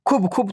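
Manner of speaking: खूब with a short, quick oo; not drawn out. Shown as a demonstration. Teacher showing learners what not to do, खूब cut short. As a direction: clip the vowel of खूब short. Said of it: "तो नहीं करेंगे ना आप".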